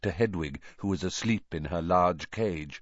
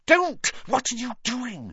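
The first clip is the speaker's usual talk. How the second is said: alarmed; 14 semitones higher than usual. Acted. Harry alarmed at head-banging.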